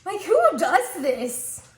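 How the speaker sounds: loud, high, drawn-out disbelief; mock horror. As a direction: exclaim in comic outrage. Mock horror at blended meatloaf.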